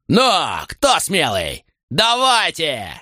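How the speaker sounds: high, slow taunting yell; shouting challenge. Acted. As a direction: dare the pirates to come on. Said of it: "закричал". (вызывающий крик)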